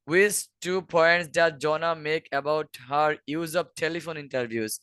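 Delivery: measured question read; slow reading. Shown as a demonstration. Teacher reading exam question to students: slow. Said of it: "reads exam question slowly".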